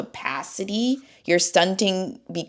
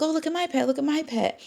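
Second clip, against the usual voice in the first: high, soft cooing imitation; imitating pet owners; 9 semitones higher, 5 dB quieter, faster than usual. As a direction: show off the pet in a small high voice. Imitating coworkers showing off pets.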